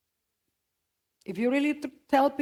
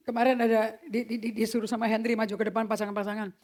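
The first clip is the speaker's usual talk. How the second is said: ordinary delivery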